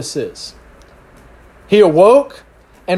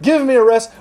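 higher-pitched, rapid-fire orders; confident device commands; 5 semitones higher than usual. Pretend. Owner talking to Alexa like a god, confident commands. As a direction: order the gadget around confidently. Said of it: "the confidence you have with those devices".

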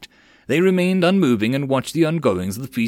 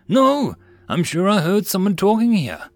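calling out; 8 semitones higher, 3 dB louder than usual. A narrator's reading. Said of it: "another one cried out".

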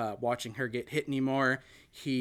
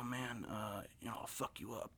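a soft Italian Brooklyn threat, mouth barely open; a half-whispered tough-guy threat, much softer; Seagal half-whisper; 3 semitones lower, 15 dB quieter than usual. Retold or imitated. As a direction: imitate Steven Seagal murmuring a menace. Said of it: "a little bit of a whisper".

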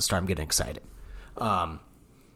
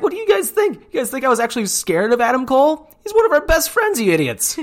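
much higher, louder taunting squeal; [name] taunting fans, high squealing mockery; mocking taunt; 6 dB louder than usual. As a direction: sneer at the fans in a shrill mock voice.

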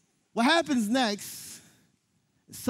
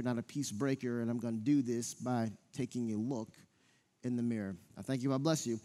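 lower, softer prayer voice before the sermon; pulpit prayer; 7 semitones lower, 5 dB quieter than usual. Acted. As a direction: pray it low and quietly for the congregation. Leads a prayer.

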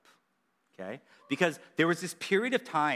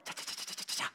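whispered brush noise; 11 dB quieter, faster than usual. Retold or imitated. Imitating brush strokes.

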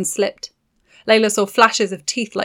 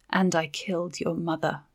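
softer, quiet confession; 7 dB quieter than usual. Acted. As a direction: say it gently, barely above a breath. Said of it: "she said softly".

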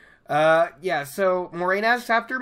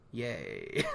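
flat, much softer mock cheer; a dry, deflated fake celebration; sarcastic yay; 6 semitones lower, 11 dB quieter than usual. Real, not acted. Sighing over having only a shower, softly sarcastic.

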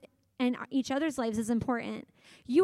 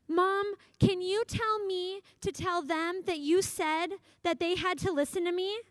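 put-on pleading kid; 6 semitones higher than usual. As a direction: wheedle mom like a young son. Son Chandler asking mom.

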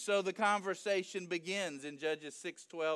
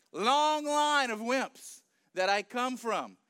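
slow, loud, pitched-up comic insistence; insistent protest; 4 semitones higher, 4 dB louder than usual. Acted. Gideon convincing the angel he is wimpy, emphatic protest. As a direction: hammer home your own wimpiness. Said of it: "He's trying to convince the angel".

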